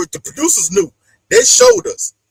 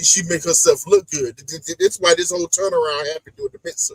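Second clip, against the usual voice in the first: ordinary talk, nothing marked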